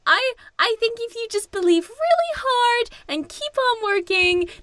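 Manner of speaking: high, fast, sweetly encouraging; Barbie sweetly encouraging the 1950s crowd; mock Barbie. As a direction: gush reassurance while hiding a secret. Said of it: "she's very much like".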